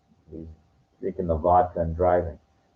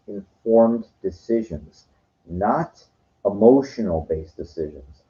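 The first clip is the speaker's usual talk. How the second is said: ordinary delivery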